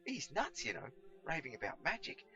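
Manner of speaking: high, lively indignant rant; exasperated rant. Acted. Pickle tells Cedric about the raving old man, exasperated. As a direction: complain about the madman.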